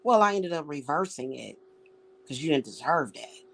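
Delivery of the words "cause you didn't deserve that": usual speaking voice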